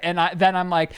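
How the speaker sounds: ordinary talk, nothing marked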